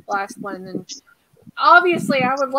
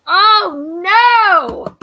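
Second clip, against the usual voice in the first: sudden high, loud cry of dismay; 9 semitones higher, 13 dB louder than usual. Real, not acted. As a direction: blurt it out as the call drops. Connection drops mid-interview.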